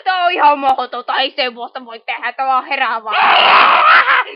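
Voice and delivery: loud indignant protest; outraged protest. Acted. Refusing to swallow thirty-six spoons, loud indignant protest. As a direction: refuse it, loudly scandalised.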